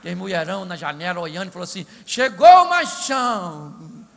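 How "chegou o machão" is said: slow, loud, swooning call; a hammed-up swooning woman; admiring women. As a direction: swoon over the hero like a woman at the window. Women at window admire David.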